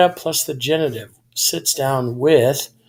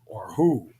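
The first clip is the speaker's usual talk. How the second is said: whispered aside; 6 semitones lower, 6 dB quieter than usual. Shown as a demonstration. Teacher adds a second meaning in a whisper. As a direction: whisper "or who." under the breath.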